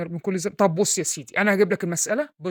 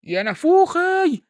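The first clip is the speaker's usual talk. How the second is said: high, slow, loud wail; anguished cry; 10 semitones higher, 6 dB louder, much slower than usual. Acted. Exasperated father wailing at his lazy son. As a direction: cry out in mock despair.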